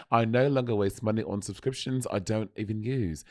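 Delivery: a light put-on British accent in an ad read; mock British accent. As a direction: hams up a British voice, softly. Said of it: "I'll do British show".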